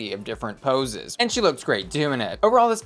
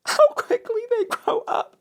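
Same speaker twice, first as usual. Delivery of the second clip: high, squeaky mock-sentimental voice; mock proud parent. About the former pit crew member, high mock-parental gush. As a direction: gush like a teary proud mom.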